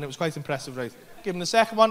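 ordinary delivery

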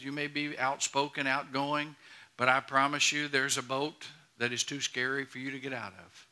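ordinary delivery

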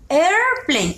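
Airplane with a long, emphatic opening vowel; stress on first sound. Shown as a demonstration. Teacher asking pupils if they hear it, first sound stressed. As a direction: stretch the start of Airplane so it stands out. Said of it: "¿Escuchas el primer sonido?".

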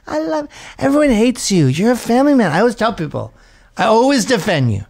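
put-on drunk gushing woman. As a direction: gush like a tipsy mom clinging to him. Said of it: "They're like".

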